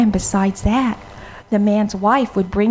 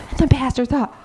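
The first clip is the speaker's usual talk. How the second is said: ordinary delivery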